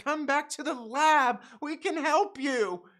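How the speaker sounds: slow, high mock plea; pleading woman. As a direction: coax him back gently in a high put-on voice. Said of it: "she tells him, like".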